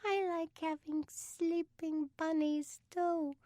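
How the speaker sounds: put-on slow, very high toddler voice; little girl, thumb in mouth. A narrator's reading. Sarah echoing Beth around her thumb, tiny sleepy voice. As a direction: talk small and high round a thumb. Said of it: "she said around the thumb".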